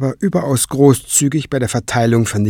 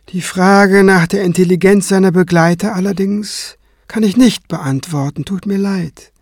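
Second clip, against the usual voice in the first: higher, cool, ironic apology; dry mockery; 8 semitones higher than usual. Kranz insulting the high priest's companions, cool and ironic. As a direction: slip the insult in politely.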